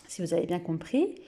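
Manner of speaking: ordinary delivery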